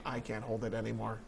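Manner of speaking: retold in a low, very soft voice; quiet strained admission. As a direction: drop right down, as if confessing privately. Recalls inner plea on long flight.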